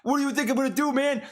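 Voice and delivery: high-pitched, exasperated Boston tailor; imitating a griping thick-accented Boston guy. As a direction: complain like a put-upon Bostonian, pitched well up. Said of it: "This guy's got a thick Boston accent".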